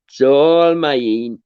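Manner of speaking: slow, stretched "It's all mine"; drawn out slowly. Shown as a demonstration. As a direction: savour every word, very slow. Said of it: "I sound terrible".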